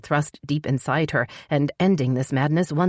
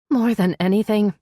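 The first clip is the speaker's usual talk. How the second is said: put-on higher, tearful young woman; heroine voice; 6 semitones higher, 4 dB louder than usual. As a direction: play her close to tears.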